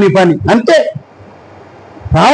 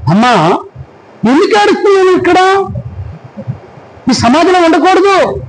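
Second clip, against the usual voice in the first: angel's question; 8 semitones higher than usual. Acted. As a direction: ask her lightly, from above. Angel speaking to weeping Mary. (దేవదూత ప్రశ్న)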